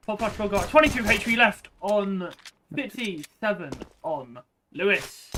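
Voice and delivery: loud, fast live play-by-play; esports caster. Acted. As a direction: call the action as it happens.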